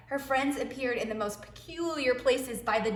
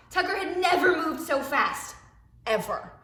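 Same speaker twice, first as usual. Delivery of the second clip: excited; 6 semitones higher, 4 dB louder than usual. A narrator's reading. Wild ride narrated excitedly.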